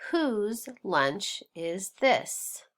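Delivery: slow, spaced-out sentence reading; read-along. Shown as a demonstration. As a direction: read it slowly, word by word, for learners. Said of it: "Let's read the next sentence".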